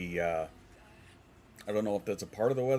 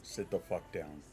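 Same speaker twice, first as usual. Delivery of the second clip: stern order; 6 dB quieter, slower than usual. Real, not acted. Scolding someone in chat.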